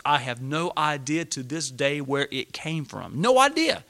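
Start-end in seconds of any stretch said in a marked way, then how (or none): 3.2-3.8 high, loud burst of disbelief; emphatic amazement. Real, not acted. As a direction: exclaim it, much higher and louder. Amazed at the unexplained money.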